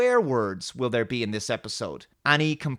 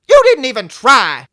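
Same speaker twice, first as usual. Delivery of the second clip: high, loud mock accusation; hammed-up yell at the captain; angry accusation; 10 semitones higher, 15 dB louder than usual. Acted Picard and Raffi quarrel.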